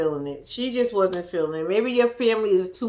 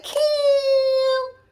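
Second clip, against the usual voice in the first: high excited yell of a name; excited greeting; 4 dB louder than usual. Retold or imitated. Party guests welcoming Cam, high excited yell. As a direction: call out the name like a cheering crowd.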